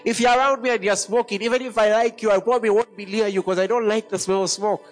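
usual speaking voice

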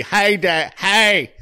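half-hearted impression of the son; mock son on the phone. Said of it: "who else would it be?".

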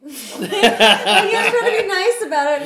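high, loud and laughing through the words; words bursting out in laughter. Real, not acted. Laughing at her own story.

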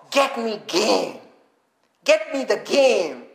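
slow command leaning hard on "game"; stress on GAME. Acted. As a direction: draw out "game" as the key word. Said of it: "there's an emphasis on the word game".

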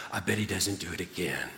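hushed, laughing confidential aside; quiet aside. Retold or imitated. Coach quietly chuckling to his player. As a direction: murmur it low with a chuckle. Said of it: "he says".

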